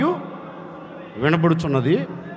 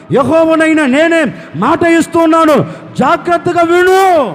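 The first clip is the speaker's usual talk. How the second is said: higher, louder, building to a ringing command; full-force preaching; 5 semitones higher, 5 dB louder than usual. Acted. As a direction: proclaim God's promise with mounting force. Preaches God's promise. (దేవుని మాటను బలంగా ప్రకటిస్తూ)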